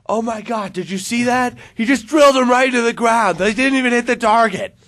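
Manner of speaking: incredulous shout climbing to a high, loud scream; screaming line coach. Retold or imitated. Line coach reacting to two missed rounds, screaming in disbelief. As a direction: holler it in appalled disbelief. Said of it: "screaming at him".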